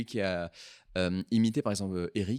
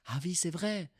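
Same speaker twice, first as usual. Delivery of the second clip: put-on mother's Sino-Cambodian accent; 8 semitones higher than usual. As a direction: imitate your mother's blunt remark in a Sino-Cambodian accent. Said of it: "avec l'accent, c'est bonus".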